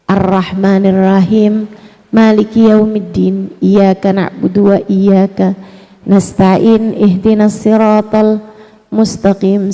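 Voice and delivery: slow, low, chanted recitation; Quran recitation. Shown as a demonstration. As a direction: intone it like prayer. Recites Al-Fatihah in Arabic. (lantunan bacaan Al-Fatihah yang pelan dan rendah)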